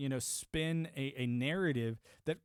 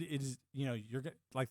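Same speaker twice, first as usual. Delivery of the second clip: usual speaking voice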